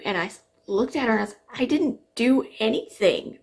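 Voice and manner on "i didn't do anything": higher-pitched, insistent innocence; retold defensive protest, rising in pitch and force; indignant protest. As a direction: plead your case, stretching the words.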